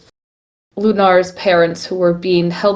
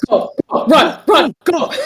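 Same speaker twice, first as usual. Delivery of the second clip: urgent; 9 semitones higher than usual. Acted. Players fleeing in character.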